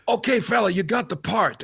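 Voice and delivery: high, loud director handing out a part; John Landis. Retold or imitated. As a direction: announce it brashly, imitating the director. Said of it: "he went".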